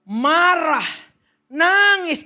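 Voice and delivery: loud, high, punched-out words; emphatic shout. Real, not acted. Preacher freeing congregation to vent to God, emphatic shout. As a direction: belt out each emotion word. Preacher urging unrestrained emotion in prayer.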